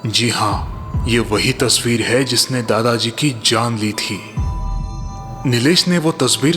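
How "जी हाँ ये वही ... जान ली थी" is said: ordinary delivery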